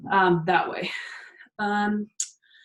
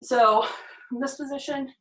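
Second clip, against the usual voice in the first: ordinary talk, nothing marked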